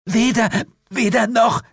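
high, squeezed stammer; strained groaning. A narrator's reading. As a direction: gasp it out while being crushed. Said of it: "ächzte Jane".

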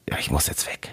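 put-on mumbled, whispered drunk quote; drunken whisper. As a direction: whisper it like a drunk friend leaving. Moik betrunken zitiert, flüsternd. (betrunkenes Flüstern)

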